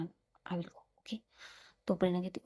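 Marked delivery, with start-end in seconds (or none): 0.0-1.2 lowered, hushed voice so the sister won't hear; hushed aside. A narrator's reading. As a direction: keep it under your breath, a secret aside. Hinting that Ananya is listening. (बहन सुन न ले, इसलिए धीमी दबी आवाज़ में)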